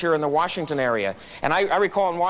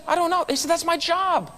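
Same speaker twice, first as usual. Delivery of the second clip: lively retold exchange; 11 semitones higher than usual. Chip and customer trade lines.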